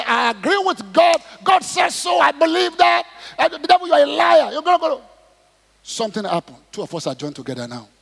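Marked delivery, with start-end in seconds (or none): 0.0-5.0 high, loud preacher's shout; shouted proclamation of faith; forceful declaration. Acted. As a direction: declare it at full force against the devil. Acts out declaring agreement with God.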